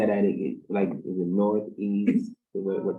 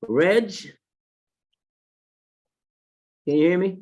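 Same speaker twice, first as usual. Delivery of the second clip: raised voice calling across the room; 8 semitones higher, 5 dB louder than usual. Real, not acted. Teacher calling to a remote student, raised voice. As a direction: call out to check he's connected.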